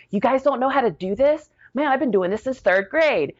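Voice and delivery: high-pitched, incredulous retold question; acted disbelief. Recruit teaching others hospital corners, acted disbelief. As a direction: act amazed that they don't know.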